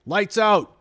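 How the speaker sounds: high bellowed command; guard shouting lights out from the hallway; roared. A narrator's reading. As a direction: bark the curfew at the boys. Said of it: "roared Jackson".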